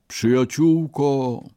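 put-on high, slow and shaky old beggar; weak trembling old man. A narrator's reading. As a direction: quaver it like a frail starving old man. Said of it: "słabym i drżącym głosem starca".

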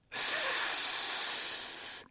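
long hiss; snake hiss. Acted. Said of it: "zasyczał wąż". (długie syczenie węża)

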